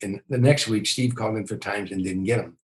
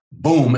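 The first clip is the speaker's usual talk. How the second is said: loud imitated bang; 5 semitones higher, 8 dB louder than usual. Jay slapping the car fender.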